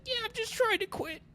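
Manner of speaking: very high, airy, chirpy voice; hammed-up high reply; mock cheery. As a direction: squeak it out breezily. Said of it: "I'm just like".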